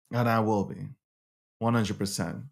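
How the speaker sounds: low, soft And I will be. Shown as a demonstration. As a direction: let And I will be fall quiet and low.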